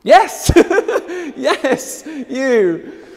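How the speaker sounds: thrilled laughing yes, high and loud; excited cheer. Real, not acted. Host cheering the audience vote winner, excited. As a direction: celebrate the winner with delight.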